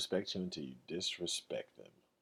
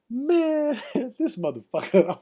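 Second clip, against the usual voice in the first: retold exasperated outburst, much higher and louder; 14 semitones higher, 10 dB louder, slower than usual. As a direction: cry out in mock exasperation, starting on a loud high drawn-out word. Retold friend venting about his boss.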